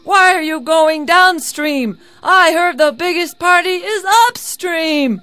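high, loud shout to a boat; calling out. A narrator's reading. Labai hails people going downstream, calling out. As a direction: holler across the river, urgent.

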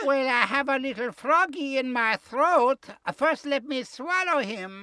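high, put-on mama falsetto; highest voice. Wolf excuses his odd voice to sheep, highest voice. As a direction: keep up the high fake-mama voice. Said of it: "in his highest voice".

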